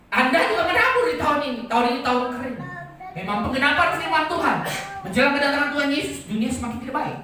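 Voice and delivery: loud, high-pitched sermon cry; impassioned preacher in full flight; full-force preaching. Real, not acted. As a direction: proclaim it at the top of your voice.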